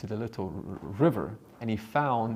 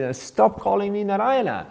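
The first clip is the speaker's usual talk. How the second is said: much higher, loud angry yell; angry shout; 12 semitones higher, 9 dB louder than usual. Acted. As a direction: yell at him to stop. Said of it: "this fella got so angry".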